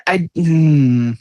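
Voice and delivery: ordinary talk, nothing marked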